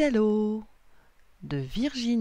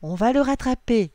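raised, louder promise; determined promise; 5 semitones higher, 7 dB louder than usual. A narrator's reading. William promises Lily to rescue the hat, determined promise. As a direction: promise it firmly and loudly. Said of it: "lui promet William".

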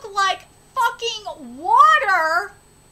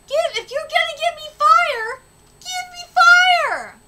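higher, louder, slowing on give me fire; excited higher shout of a demand; 8 semitones higher, 5 dB louder than usual. Real, not acted. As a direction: insist like a fan who wants fire. Streamer demanding fire art.

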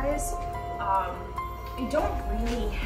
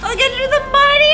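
frantic; 3 semitones higher, 10 dB louder, much faster than usual. Acted. Frantic fast shout.

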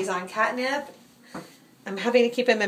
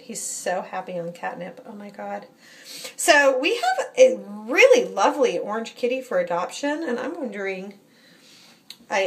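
usual speaking voice

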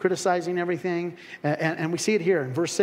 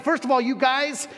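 scolding critics; 6 semitones higher, 4 dB louder than usual. Retold or imitated. Critics scolding the roof-breakers.

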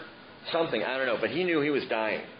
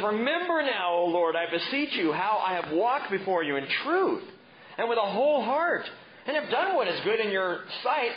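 whining plea; 9 semitones higher than usual. Acted. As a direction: beg like a sulking king. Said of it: "so he threw a tantrum".

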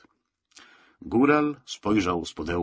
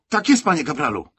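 higher, louder snappy parade-ground reply; dutiful shout; 7 semitones higher, 7 dB louder than usual. A narrator's reading. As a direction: bark it out like a keen recruit. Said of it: "wykrzyknął służbiście Kalina".

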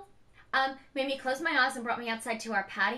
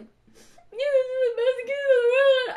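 high, loud, slow tearful cry; retold bawling; 13 semitones higher, 11 dB louder, slower than usual. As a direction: sob it out, overwhelmed with love for the kids. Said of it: "I mean, I was bawling".

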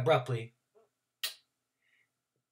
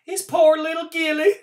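put-on old country woman; 10 dB louder than usual. Trotter voice.

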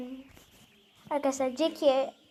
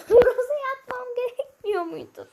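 laughing; 7 semitones higher, 4 dB louder than usual. Real, not acted. Laughing at own mistake.

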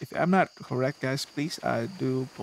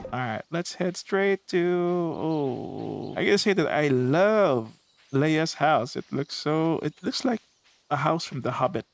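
ordinary delivery

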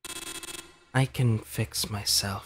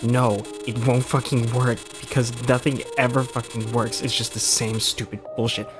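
swearing, faster and pushing harder; angry rant. Acted. Blanc venting years of frustration at her rescuer, angry. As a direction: rant it out, fed up and bitter.

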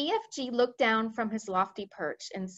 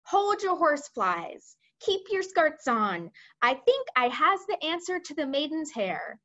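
higher-pitched giant, slowing on the odd phrases; BFG, eager; 6 semitones higher than usual. Acted. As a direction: eagerly offer an idea in a high voice. Voices the BFG.

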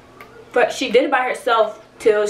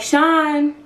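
mildly raised, higher call of a name; 5 semitones higher than usual. Retold or imitated. Woman calling Sean to the phone, imitated call.

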